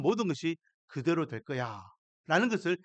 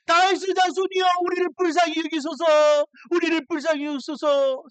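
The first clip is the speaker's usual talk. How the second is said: loud, much higher desperate plea; crying out; 11 semitones higher, 6 dB louder than usual. Retold or imitated. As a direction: shout for mercy across the crowd. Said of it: "소리 지르면서 부릅니다". (예수님께 자비를 구하며 아주 높고 크게 외치는 소리)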